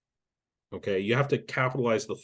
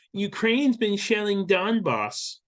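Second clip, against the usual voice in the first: slow, high mock accusation; scornful imitation, pitched up; mocking quote; 9 semitones higher, slower than usual. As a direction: parrot the claim in a raised, stretched voice. Mocks an opponent's objection.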